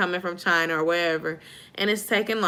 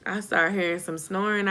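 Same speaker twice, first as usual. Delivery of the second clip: ordinary delivery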